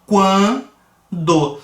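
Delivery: "quando" crescente nasal; demo word. Shown as a demonstration. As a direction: grow from the u into the an of "quando". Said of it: "a gente cresce de uma semivogal para uma vogal".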